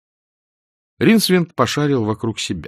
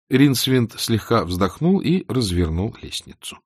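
plain reading voice

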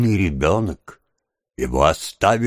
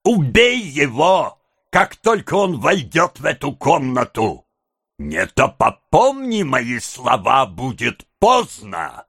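slow, menacing tirade in a raised voice; drawn-out, heated threats; out of patience; 5 semitones higher, slower than usual. A narrator's reading. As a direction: threaten deliberately, pitch climbing with fury. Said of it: "окончательно выведенный из терпения".

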